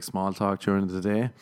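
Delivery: ordinary delivery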